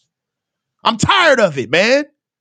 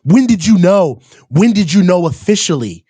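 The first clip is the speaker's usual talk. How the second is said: mock reporter; 8 semitones lower than usual. Mocking reporters' questions.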